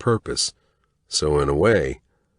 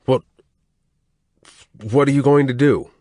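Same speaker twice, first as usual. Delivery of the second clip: stammering, much higher and louder in panic; a beaten man begging in fear; terrified captive stammering out the question; frightened stammer; 12 semitones higher, 6 dB louder than usual. A narrator's reading. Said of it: "Eli stammered".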